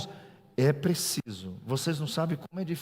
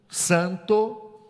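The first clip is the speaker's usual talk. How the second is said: santo repeated, higher and louder with a rise; stress on santo; 6 semitones higher, 6 dB louder than usual. Shown as a demonstration. Pastor repeating to the church, santo punched loud. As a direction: hit santo hard.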